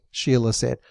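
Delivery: ordinary delivery, nothing marked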